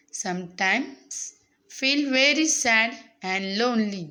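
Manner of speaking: very slow, phrase-by-phrase reading; teacher's slow read-aloud. Acted. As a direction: read it out slowly for young learners, pausing between phrases. Reads the English text aloud to students. (सावकाश, थांबत थांबत वाचन)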